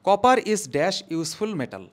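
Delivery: copper is dash read very slowly and loud; teacher reading the blank question slowly. Shown as a demonstration. Teacher reads exam sentence to students, slow and loud. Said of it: "reads the question out".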